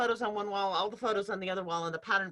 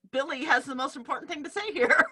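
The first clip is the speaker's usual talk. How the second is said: laughing mock; 5 semitones higher, 5 dB louder than usual. Shown as a demonstration. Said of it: "So it's not".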